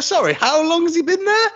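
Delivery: high, baffled disbelief; mock incredulous query; incredulous question. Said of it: "I was like".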